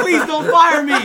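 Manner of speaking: loud, high laughing plea; panicky plea. Retold or imitated. Retold plea to the actress not to be fired, loud and laughing. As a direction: beg through the laughter.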